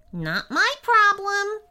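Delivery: high, sing-song brush-off; dismissive imp. A narrator's reading. Imp waves away the boy's objection, dismissive.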